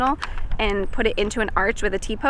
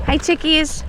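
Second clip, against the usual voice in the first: high cooing greeting; cooing at chickens; 6 semitones higher, 4 dB louder than usual. Real, not acted. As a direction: sweet-talk the hens.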